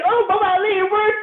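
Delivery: high, loud, laughing cry of joy; excited husband. Retold or imitated. Husband celebrating to the speaker that it worked, laughing high and loud. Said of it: "You're like".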